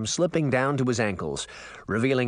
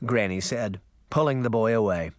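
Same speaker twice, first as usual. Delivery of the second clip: plain reading voice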